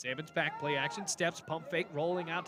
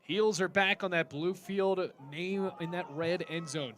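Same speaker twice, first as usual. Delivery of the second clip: ordinary delivery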